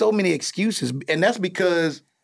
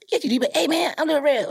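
higher, teasing imitation of dad's acting voice; son mocking dad; 11 semitones higher than usual. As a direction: son ribbing his dad's showbiz voice. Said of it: "you get really high pitched and talk fast".

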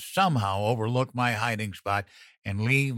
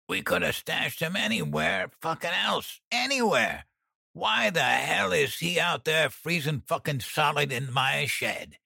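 put-on high, frantic cursing, half drunk; tipsy panic, raised pitch; anxious angry rant; 6 semitones higher than usual. A narrator's reading. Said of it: "beside himself with anxiety".

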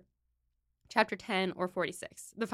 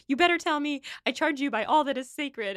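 higher, pressing demand; mock angry demand; 6 semitones higher than usual. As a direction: act Emma insisting fiercely. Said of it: "like, yelling at him, pissed".

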